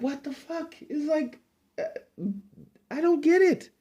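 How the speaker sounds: exasperated disbelief. Real, not acted. Host baffled by the booking, voice shooting up in disbelief. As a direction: voice jumps way up in disbelief. Ranting about stupid booking.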